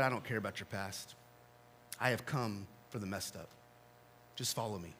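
hushed and gentle, almost whispered; soft tender reassurance. Acted. Jesus speaking gently to Matthew, soft and reassuring.

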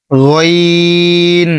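গইন held long from mid-throat; drawn-out Arabic letter. Shown as a demonstration. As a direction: stretch গইন from the middle of the throat. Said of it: "গলার মধ্যখান". (গইন গলার মাঝখান থেকে টেনে)

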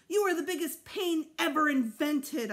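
put-on boy yelling at his little brother; yelled. A narrator's reading. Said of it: "I yelled".

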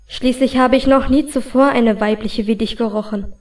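ordinary delivery

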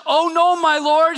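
loud, high cry of dismay. Acted. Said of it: "he cried out".

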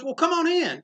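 imitating Eisner. Opening his hotel door to John, Eisner greets him in a lifted voice. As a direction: lift the voice way up in a warm greeting.

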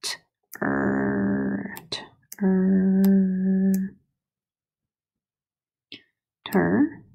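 "Tur-" repeated, last one whispered; teacher sounding the first syllable of "turtle". Shown as a demonstration. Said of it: "do my first syllable".

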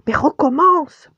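high, loud, impatient order; ordre exaspéré. A narrator's reading. Ordre exaspéré de reprendre les excuses. (injonction aiguë et impatiente)

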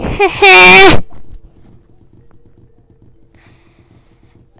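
loud burst of laughter. Real, not acted. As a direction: laugh out loud after scream. Laughs after screaming.

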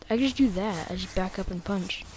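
usual speaking voice

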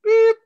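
sharp, high, loud mock beep. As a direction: beep like an answering machine. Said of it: "outgoing message".

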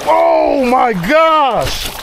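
drawn-out, very slow cry of amazement; amazed outcry. Real, not acted. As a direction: stretch each word out in awe. Lands a huge fish.

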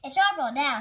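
loud taunting claim; quarrelling. A narrator's reading. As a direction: claim it loudly, like a playground bully. Big kid quarrels over ball.

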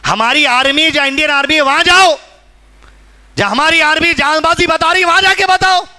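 rising, high-pitched rhetorical demand; impassioned challenge. Real, not acted. Preacher challenging reporters to go to the army, impassioned.

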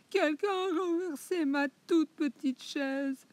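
very high, piercing little bear voice. Acted. Le petit ours découvre sa chaise, voix aiguë. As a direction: squeal it like a tiny cub. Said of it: "petite voix perçante".

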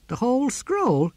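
high, drawn-out question; slow, high-pitched amazement; incredulous. Acted. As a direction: stretch it out in disbelief. Ern doubts the memory feat.

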